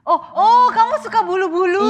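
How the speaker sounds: high, loud excited reaction; excited surprise. Real, not acted. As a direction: burst out in delighted surprise. Reacting to friend's bedding habit.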